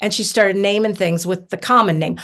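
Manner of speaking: normal talking voice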